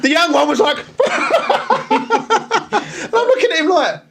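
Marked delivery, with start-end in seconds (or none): 0.8-1.8 imitated high laugh. Young officer laughing imitated.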